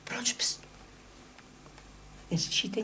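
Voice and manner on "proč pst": whispered shushing; urgent whisper to keep quiet; hushed whisper. Acted. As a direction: breathe it without voice, straining to listen. Hushing to listen for sounds.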